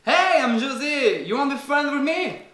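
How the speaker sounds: high, very slow Mexican drug dealer voice; José. Acted. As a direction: drawl a creepy friend request, high-pitched. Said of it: "José, trafiquant de drogue, mexicain". (trafiquant mexicain à la voix aiguë et traînante)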